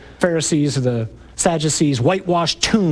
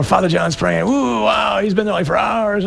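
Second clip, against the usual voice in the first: awed gushing parishioner; 5 semitones higher than usual. Retold or imitated. Parishioner gushing at the praying priest, mock awe. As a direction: gush over the praying priest. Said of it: "you look at us and go".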